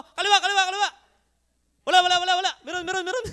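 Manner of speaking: loud, teasing shouts of wrong directions, with a laugh; mock opponent shouting. Opponent yelling to confuse the blindfolded player, loud and laughing. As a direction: heckle the blindfolded player like a rival.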